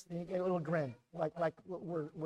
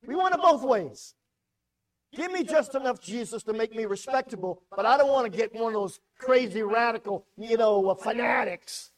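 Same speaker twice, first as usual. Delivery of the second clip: loud, high, driving sermon voice; full-force revival preaching; 5 semitones higher, 11 dB louder than usual. Acted. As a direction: preach it hard to the whole room.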